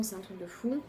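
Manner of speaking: ordinary talk, nothing marked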